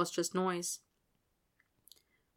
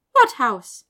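higher, louder, upbeat encouragement; encouraging; 5 semitones higher, 12 dB louder than usual. Acted. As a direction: cheer the shy boy on. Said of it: "Hestia Jones said encouragingly".

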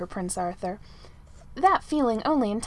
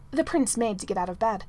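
ordinary delivery, nothing marked

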